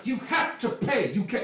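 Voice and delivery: high, strained plea to let go; panicked elf. Acted. Fleeing elf begs the halfling to let go, high and frantic. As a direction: elf yanking free, pleading in a high voice. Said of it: "it's like".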